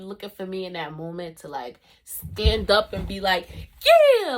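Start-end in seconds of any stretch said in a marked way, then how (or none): 3.8-4.4 loud, high, drawn-out cheer of agreement; rousing backing shout. Retold or imitated. Standing up to support classmate, rousing shout. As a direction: jump in to back a friend loudly. Imagines standing up to back him.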